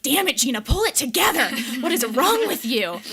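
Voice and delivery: loud, high, exasperated retold yell; hammed-up telling-off of oneself; mock self-berating. Crying driver berates herself at the wheel, mock yelling.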